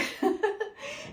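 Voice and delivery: short, high-pitched laugh; amused laugh. Real, not acted. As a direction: laugh at your own slip, high and quick. Laughs at missing it for a year.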